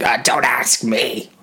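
a hint of a sulky robot, slow at first; a mild odd android voice; lightly voiced android. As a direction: grumble like a lazy android. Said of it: "why does he sound like this?".

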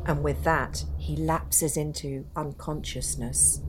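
dark storyteller voice, building tension; ghost story narration. A narrator's reading. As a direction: tell it like a campfire ghost story.

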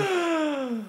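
sharp high gasp; shocked gasp. Real, not acted. Shocked by a dirty joke.

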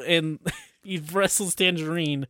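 ordinary talk

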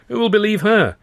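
high, loud scoffing sneer. A narrator's reading. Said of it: "said with a sneer".

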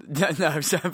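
giggling apology; laughing. Real, not acted. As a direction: laugh through it.

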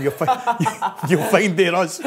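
punchline breaking up into high, loud laughter; real laughter bursting through the words; laughing. Real, not acted. Teammate cracking up at his own punchline, laughing.

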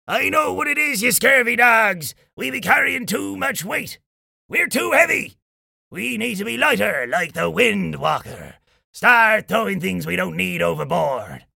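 high-pitched rallying shout; pirate captain's shout. A narrator's reading. Old Jim has an idea to lighten the ship, shouting. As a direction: rouse the crew with a salty pirate call. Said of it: "he shouted".

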